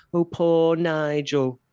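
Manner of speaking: slow, drawn-out sarcastic sigh of pity; mocking pity. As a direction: stretch it out like a fake sympathiser. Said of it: "They're all like".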